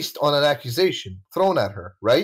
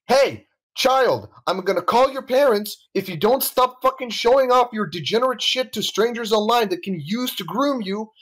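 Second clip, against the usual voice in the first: put-on higher-pitched telling-off of an imagined child; mock scolding; 5 semitones higher than usual. Speaker addressing an absent child about showing off online, mock scolding. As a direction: scold like a fed-up adult threatening consequences.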